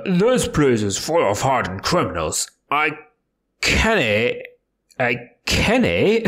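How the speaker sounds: gruff Scottish governor, lightly voiced; a hint of a burly Scottish jailer; Scottish accent. Game player voicing the prison governor in Scottish. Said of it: "how do I voice him?".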